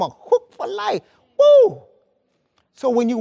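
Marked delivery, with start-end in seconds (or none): 1.4-1.8 a high, loud joyful whoop; joyful woo. Real, not acted. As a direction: burst out with a shout of joy. Preacher erupts in joy mid-sermon.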